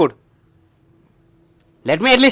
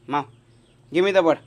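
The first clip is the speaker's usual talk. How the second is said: much louder, higher command; loud demand; 5 semitones higher, 13 dB louder than usual. Real, not acted. Owner loudly demanding the bird from his cat. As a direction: order the cat to hand it over.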